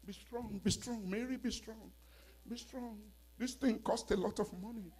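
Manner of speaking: high-pitched pep talk to oneself; mock self-encouragement. Voices self-talk against crying.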